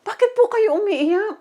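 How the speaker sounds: high, urgent call of Father; pleading cry to Father. Retold or imitated. Believer calling to weeping God in vision, high and pleading. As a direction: plead up high, worried for him. Asking God why he weeps.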